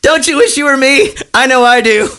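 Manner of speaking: cocky quote breaking into laughter; smug gleeful quote. Retold or imitated. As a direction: brag like a giddy rich drunk. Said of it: "he just goes".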